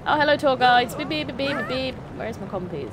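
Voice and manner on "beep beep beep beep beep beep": mock car horn beeps. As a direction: beep them out of the way. Honking at tour guides.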